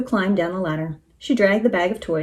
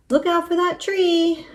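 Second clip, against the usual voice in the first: high, drawn-out warning call; warning cry; 10 semitones higher, slower than usual. A narrator's reading. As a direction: call out the danger, high and slow. Shouted warning in wild sleigh ride.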